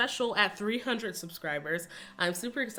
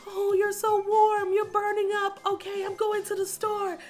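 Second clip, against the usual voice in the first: much higher, louder fussing mother; imitating mom nursing her sick daughter; mama mode; 14 semitones higher, 5 dB louder than usual. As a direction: bustle like a worried mom taking charge. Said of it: "full mama mode".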